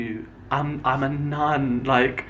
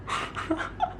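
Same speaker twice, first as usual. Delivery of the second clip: airy high-pitched giggle; high breathless giggle; 9 dB quieter than usual. Real, not acted. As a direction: laugh up high, almost silent. Laughs at absurd rumor.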